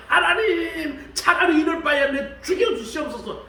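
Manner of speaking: desperate wail. Retold or imitated. Preacher voicing Job begging God, wailing. Job's desperate prayer voiced.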